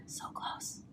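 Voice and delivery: playful teasing whisper. Pretend. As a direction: lean in and whisper.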